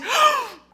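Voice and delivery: very high, loud gasp; high-pitched fangirl gasp; overwhelmed gasp. Real, not acted. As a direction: gasp dramatically. Overwhelmed by the book.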